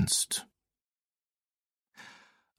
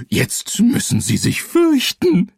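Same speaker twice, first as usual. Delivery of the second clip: high, insistent ghost voice; spooky ghost. Acted. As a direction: demand it like a pouting ghost. Ghost demands to be feared.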